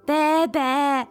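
lautes, hohes, spöttisches Blöken; höhnisches Meckern. Acted. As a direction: das Rentier neckend nachäffen. (hohes Spottblöken, laut)

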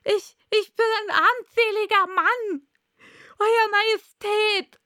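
high, loud, desperate stammer; flehender Hutmacher. Acted. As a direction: beg the king at the top of the range. Hatter kneels and begs. (hoch, laut und verzweifelt flehend)

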